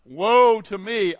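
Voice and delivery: loud, slow anguished cry of the prophet; Isaiah crying out. Acted. Isaiah's cry quoted in sermon.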